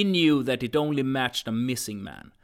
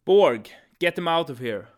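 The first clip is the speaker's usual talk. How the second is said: loud, firm command; stern order; 4 semitones higher, 8 dB louder than usual. Acted. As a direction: bark the order. Agent ordering guard.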